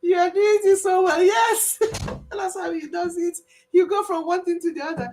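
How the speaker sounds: squealing with delight; laughing. Real, not acted. Praising the mimic to viewers while laughing.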